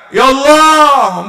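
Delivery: loud, slow, drawn-out call to God; crying out. Shown as a demonstration. Preacher showing the crowd how to call out, loud and drawn out. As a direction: cry out with full force. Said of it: "bengok ngono lho".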